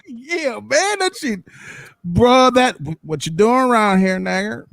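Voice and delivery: put-on country accent. The country guy he fears, in a mock Southern accent. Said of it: "That whole country shit".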